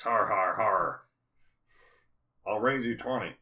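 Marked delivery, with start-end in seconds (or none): 0.0-1.0 loud fake har har; fake mocking laugh. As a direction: bark out a mock laugh.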